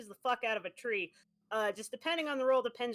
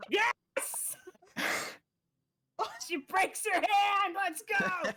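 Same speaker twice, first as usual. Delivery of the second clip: high loud gleeful cheering; excited cheer; 9 semitones higher, 3 dB louder, much slower than usual. Real, not acted. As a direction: celebrate the roll. Cheering at the roll.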